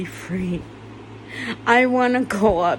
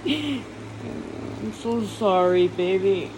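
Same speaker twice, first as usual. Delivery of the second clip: slow and softer, barely getting it out; soft broken apology; 6 dB quieter, slower than usual. Real, not acted. Grieving speaker apologising to Alex, slow soft voice. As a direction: let the apology sink into a slow hush.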